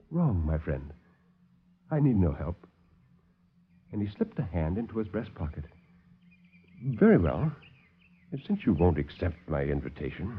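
low, soft, smooth villain; calm the visitors like a silky host; suave doctor. Acted. Doctor soothes the alarmed officers, low and soft.

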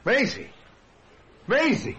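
high-pitched cry of her name; startled high shout. Acted. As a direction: call out to her in astonishment. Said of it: "Dick shouted".

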